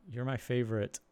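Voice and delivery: ordinary talk, nothing marked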